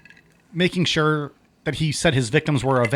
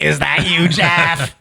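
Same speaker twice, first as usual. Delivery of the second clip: louder, slower raspy smoker granny; smoker's voice grandma; 5 dB louder, slower than usual. Pretend. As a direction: play a chain-smoking grandma. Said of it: "the smoker's voice".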